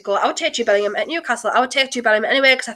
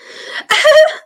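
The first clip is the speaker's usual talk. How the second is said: high teary laugh; tearful laugh; 6 dB louder than usual. Real, not acted. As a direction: laugh through tears. After angry tears.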